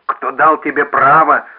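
loud, slow, gasping outcry; breathless shout. A narrator's reading. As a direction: shout it while short of breath. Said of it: "задыхаясь, крикнул Невилл".

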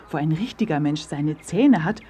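usual speaking voice

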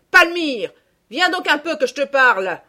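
high, loud summons; angry mother calling from afar. A narrator's reading. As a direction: shout sternly for your daughter to come. Mother calls her daughter over.

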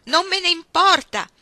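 loud, high, angry retort; defiant shout. Acted. Iggy to Ruth who scolds her screaming, defiant shout. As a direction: yell back that you don't care.

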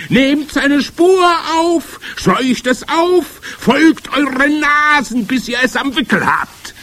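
slow, very high, loud shrieking; screamed orders. A narrator's reading. Head witch drives the coven to hunt the child, screaming. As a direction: scream each command with long stretched words. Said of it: "schrie die Hoch- und Großmeisterhexe".

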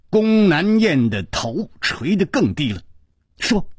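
ordinary delivery, nothing marked

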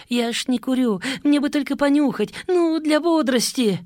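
high, rising whiny plea; whining. Acted. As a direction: beg pitifully. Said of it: "конючил Гришаня".

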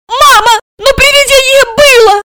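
put-on very high, loud child's cry; little girl passionately protesting; heated exclamation. A narrator's reading. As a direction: insist fervently to mum. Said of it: "воскликнула с жаром Алиса".

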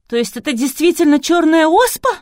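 loud, high, rising panic; horrified outcry. A narrator's reading. Vasilisa learns it may be smallpox, horrified. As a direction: cry out in horror, voice way up. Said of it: "в ужасе выкрикнула Василиса".

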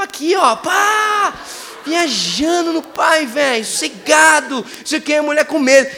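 high, loud excited telling of the bus scare; excited shout. Retold or imitated. Preacher reliving scaring a woman on the bus, loud excited shout.

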